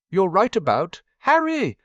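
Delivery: put-on high and loud girlish squeal; excited shriek. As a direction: burst into the room squealing with gossip. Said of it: "Lavender and Parvati shrieked".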